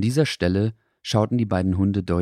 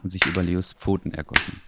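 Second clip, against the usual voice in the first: usual speaking voice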